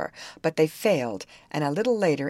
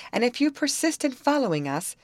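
high-pitched sharp warning; 9 semitones higher than usual. A narrator's reading. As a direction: warn them off sharply. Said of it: "said Betty sharply".

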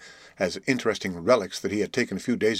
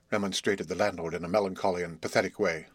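plain reading voice

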